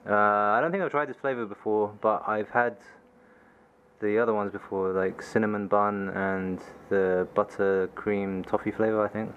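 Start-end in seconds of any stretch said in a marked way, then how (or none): none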